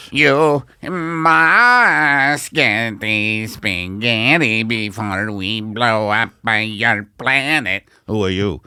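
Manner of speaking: slow, loud, threatening Italian alien. Pretend. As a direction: menace them slowly like a pushy alien. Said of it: "Italian alien".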